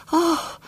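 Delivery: high startled gasp; a tiny shocked intake; little gasp. A narrator's reading. Frightened aunt gasping at the news, high little gasp. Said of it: "gave a little gasp".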